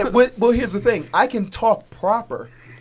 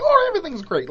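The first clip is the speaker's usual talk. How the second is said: higher, prim polite mock voice; 9 semitones higher, faster than usual. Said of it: "Like, we're mocking".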